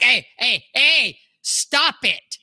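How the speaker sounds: a hint of a fed-up kid dragging out stop it; mock scolding kid. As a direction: play the boy nagging his dog to stop. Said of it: "Kelly'd be like".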